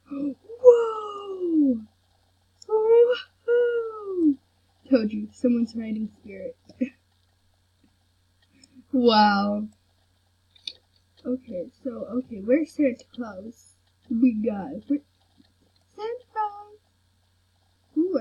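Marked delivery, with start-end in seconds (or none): 0.1-4.3 high, long falling whoas; gasp, then let out high, drawn-out wonder; amazed. Real, not acted. Player watching riders in the game, amazed. 15.9-16.8 high, soft call; singsong calling. Real, not acted. Player searching for Santa Claus, singsong calling.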